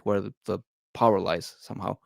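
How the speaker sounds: usual speaking voice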